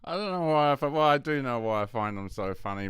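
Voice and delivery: ordinary delivery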